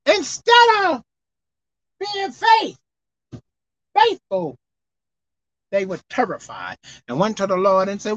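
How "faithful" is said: normal talking voice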